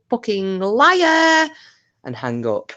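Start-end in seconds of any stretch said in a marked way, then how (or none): 0.1-1.5 much higher, louder and very slow; drawn-out insult. Retold or imitated. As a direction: stretch the insult out, loud and high. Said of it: "at that point I go".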